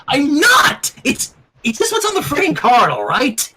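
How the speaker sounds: put-on flustered teen denial; hot protest. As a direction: snap back defensively. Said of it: "said hotly".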